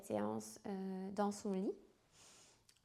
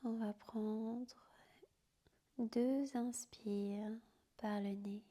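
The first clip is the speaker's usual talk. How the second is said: guided sleep meditation. Acted. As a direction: whisper them gently down into sleep. Yoga nidra guided to sleep.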